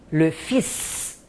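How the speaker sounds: le fils with the final s sounded; French fils. Shown as a demonstration. Teacher showing students the sounded s in fils. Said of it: "Eu sou obrigada a falar o S".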